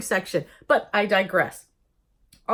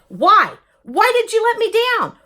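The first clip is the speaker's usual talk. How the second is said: much louder, higher accusing cry; demanding why; 11 semitones higher, 10 dB louder than usual. Shown as a demonstration. Demanding answers from God, loud accusing shout. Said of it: "is so much different than".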